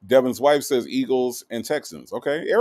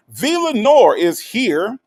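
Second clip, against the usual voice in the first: excited greeting; 9 semitones higher, 7 dB louder, slower than usual. Real, not acted.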